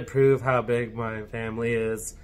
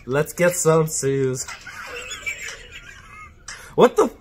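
speech jammer struggle; 5 dB louder than usual. Real, not acted. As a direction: drag the words out, fighting feedback. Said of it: "Speech Jammer app".

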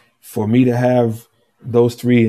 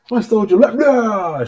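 wife's startled echo; 10 semitones higher than usual. Retold or imitated. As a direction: mimic her shocked disbelief. Wife echoing in disbelief.

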